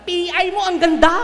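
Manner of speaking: high, loud mock curse at a pretty bird; swearing exclamation. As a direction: blurt it out like a crude exclamation. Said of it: "ang sasabihin".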